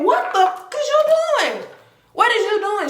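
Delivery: high, shrill mock screech; shrieking outrage. Cousin catching her in the car, high outraged screech. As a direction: screech at her to explain herself. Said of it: "She said".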